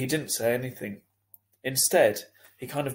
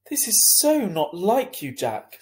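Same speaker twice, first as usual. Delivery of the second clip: high, louder mom voice, hurt and disbelieving; upset mum; 8 semitones higher, 5 dB louder, slower than usual. A narrator's reading. Mother scolding her son in the principal's office, dismayed and high.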